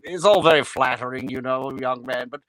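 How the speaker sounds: gently condescending advice in an affected stage voice; lofty thespian brushing off an admirer; Hans Conried. Retold or imitated. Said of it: "He, he says".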